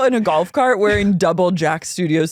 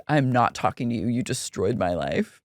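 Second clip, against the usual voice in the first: low, subdued mock grievance; deep, sulky retold accusation; Jax imitation; 7 semitones lower, 6 dB quieter than usual. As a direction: imitate a wounded man, dropping low and soft. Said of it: "Jax goes".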